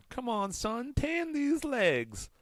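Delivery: put-on high, drawn-out old-lady plea; imitating elderly woman. Quoting her whenever in shorts, high drawn-out imitation. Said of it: "I still quote her".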